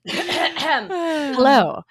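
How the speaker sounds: a big, drawn-out, theatrical hello; mock grand hello. Guest hamming a grand intro on the podcast. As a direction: greet like a swashbuckling hero. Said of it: "it's hard to not say my name is Indingo Montoya".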